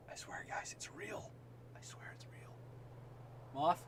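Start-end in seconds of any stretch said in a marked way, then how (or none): 0.1-2.5 soft unvoiced whisper. Real, not acted. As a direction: whisper to viewers. Whispering to viewers.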